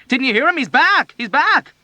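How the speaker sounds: high loud insistent shouting. A narrator's reading. Patient shouting at another in panic. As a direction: yell it over the chaos.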